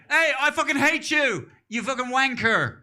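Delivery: loud, high-pitched comic rant; mock angry insult. Comic abusing an imaginary enemy, mock angry shout. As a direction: hurl it out in hammed-up anger. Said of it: "someone you're angry with".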